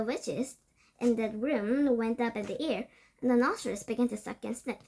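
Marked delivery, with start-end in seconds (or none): none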